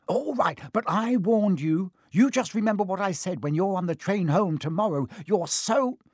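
high, indignant telling-off; huffy warning. Acted. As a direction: warn them crossly, building until cut off. Hermione's huffy warning, cut off.

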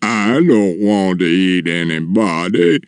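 slow, drawn-out giant voice; sorrowful, slow giant; tearful giant. A narrator's reading. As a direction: confess miserably as a huge brute. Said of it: "a giant tear rolled down".

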